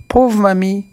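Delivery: put-on high, pitying childlike exclamation; child's cry. A narrator's reading. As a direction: cry out again for the fallen witch. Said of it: "Petit Pierre s'écrie".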